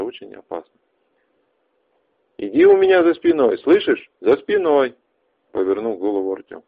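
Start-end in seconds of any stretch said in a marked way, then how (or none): 2.4-5.0 urgent shout. Acted. Urgent warning near anomaly.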